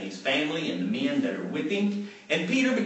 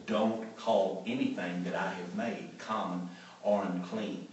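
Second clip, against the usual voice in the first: softer, lower rebuke; quiet but firm correction; hushed divine rebuke; 9 semitones lower, 9 dB quieter than usual. Retold or imitated. Heaven's voice gently correcting Peter, softer and lower.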